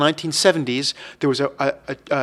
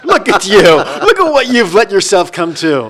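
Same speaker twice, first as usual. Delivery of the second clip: slow, louder scolding voice; mocking mirror; 3 semitones higher, 7 dB louder, slower than usual. The bathroom mirror mocking Phil's paunch, slow and scornful. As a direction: sneer it like a mirror mocking you.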